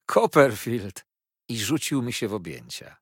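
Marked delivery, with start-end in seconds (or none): 0.1-1.1 loud, drawn-out happy greeting; overjoyed cry of recognition; joyful exclamation. A narrator's reading. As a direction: call the friend's name out slowly and loudly. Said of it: "zawołał, ujrzawszy mnie".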